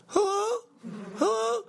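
put-on very high falsetto girl voice; girl caller. Girl calling in to the show, high mock falsetto. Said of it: "the girl calls in, she goes".

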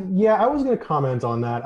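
ordinary talk, nothing marked

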